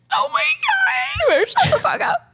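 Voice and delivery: very high, squeaky fake sobbing; mock crying meltdown. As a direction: play falling apart at kind words. Mock meltdown at being gently told off.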